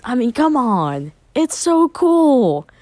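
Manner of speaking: gushing excitement. Acted. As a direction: stretch out the words, thrilled by a cool toy. Naruto gushing over the mask.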